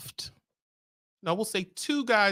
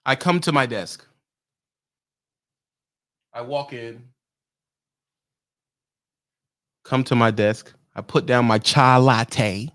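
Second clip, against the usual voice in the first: lower, rushed, mocking office-boss voice; a smug dispatcher talking down to a driver; imitating King's dispatcher; 5 semitones lower than usual. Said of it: "I'm King's D M".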